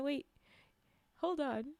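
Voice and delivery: plain reading voice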